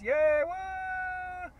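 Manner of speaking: high, loud whoop of joy; excited cheer. Real, not acted. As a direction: cheer it out like a celebration. Cheering a subscriber milestone.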